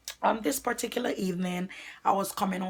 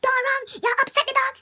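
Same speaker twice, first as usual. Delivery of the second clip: high, loud yell of a frantic neighbour; screaming lady; 5 dB louder than usual. Retold or imitated. Neighbour lady yelling at the fleeing girl, high and loud. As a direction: shriek it from up the stairs. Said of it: "the lady screaming".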